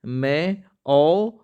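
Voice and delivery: very slow letter by letter; slow spelling out. Shown as a demonstration. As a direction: dictate pe re mein o slowly. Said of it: "परमौषधि ऐसे लिखते हैं". (धीरे-धीरे वर्तनी बताते हुए)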